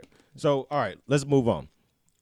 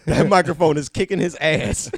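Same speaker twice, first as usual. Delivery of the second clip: laughing; 6 semitones higher, 10 dB louder than usual. Real, not acted. Laughing at friend's struggle.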